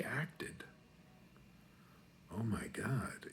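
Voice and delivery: hushed, breathless whisper; awed whisper. Real, not acted. Comic recalling his drama teacher, whispering in awe. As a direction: whisper it like a sacred memory.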